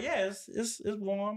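high-pitched mock employee voice; clueless employee. Employee reports back on the nacho cheese, high mock voice. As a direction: imitate a clueless worker in a high voice.

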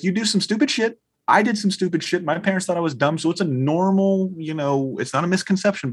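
high-pitched retold justification; explaining dad. Dad justifying himself to his son, defensively.

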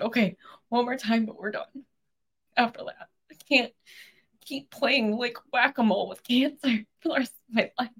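tearful, high, strained plea; choked up but resolved, in short bursts; emotional. Real, not acted. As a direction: speak through real tears, voice lifted. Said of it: "I get emotional".

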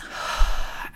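slow, audible breath; long, deliberate breath as an example; big deep breath. Shown as a demonstration. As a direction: demonstrate a calming deep breath. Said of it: "I took a big, deep breath".

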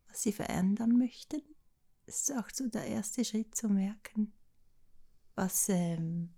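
drowsy, fading, slow words; nearly falling asleep mid-sentence; nodding off. Real, not acted. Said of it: "ich schlafe fast ein".

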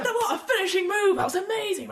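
high, loud gushing praise; elated fan's shouting; excited crowd. Acted. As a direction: gush like a thrilled spectator. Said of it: "you just hear people go".

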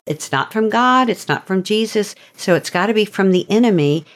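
ordinary talk

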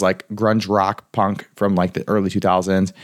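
ordinary delivery

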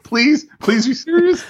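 plead, hammed up; host begging it's no joke, mock plea. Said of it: "I was like".

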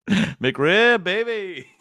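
high-pitched, drawn-out hammed-up cheer; mock gleeful boast. American answering foreign critics, mock gleeful boast. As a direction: crow it like a silly fan.

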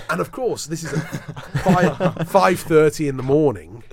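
can't stop laughing at the memory. Real, not acted. Recalling the early-hours typo to the hosts, laughing. Laughs recounting embarrassing error.

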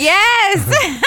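loud, high, delighted yes; excited. Real, not acted. Quizzer hearing the right guess at last, excited. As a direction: burst out laughing on a triumphant shout.

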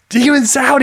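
high commanding cry; hammed-up prophet's exorcism cry; casting out demons.

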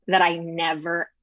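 ordinary talk, nothing marked

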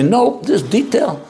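high, slow and dug in over one detail; cling to the detail, won't budge; stubborn insistence. Retold or imitated. Someone emotionally caught up in a detail, stubbornly insisting. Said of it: "you say".

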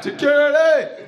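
high, drawn-out yell for security; calling for help. Retold or imitated. Stupid heckler yelling for security, drawn-out call.

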